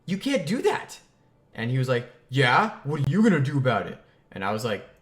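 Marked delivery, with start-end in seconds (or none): 0.1-1.0 high, loud outraged yell; indignant childish yell. Retold or imitated. Dirt thrown in his face. 2.3-4.0 put-on louder, fast, goading kid voice; mocking playground taunt; 1 taunting bully. Neighbor kid taunting in argument.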